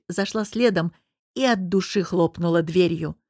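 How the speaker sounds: ordinary delivery, nothing marked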